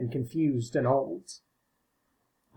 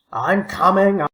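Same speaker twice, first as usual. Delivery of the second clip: louder, higher call across the garden; 5 semitones higher, 6 dB louder, slower than usual. A narrator's reading. Old man answers the shout from the loo, louder call. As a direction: call out to be heard. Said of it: "Mister Thomas called".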